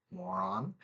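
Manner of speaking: a quick under-the-breath dig at herself; self-mocking aside. Real, not acted. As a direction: mutter it as a joke on yourself. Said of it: "I'm calling myself a moron".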